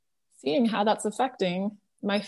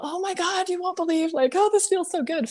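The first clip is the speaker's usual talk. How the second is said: high, gushing excitement; ecstatic; 9 semitones higher than usual. Retold or imitated. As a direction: squeal it like pure joy. Quoting her own past ecstatic self.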